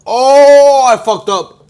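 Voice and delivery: loud high cry of pain, mouth burning; pained shout. Real, not acted. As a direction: yell out in real pain. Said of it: "you guys see my eyes".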